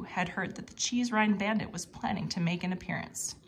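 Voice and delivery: usual speaking voice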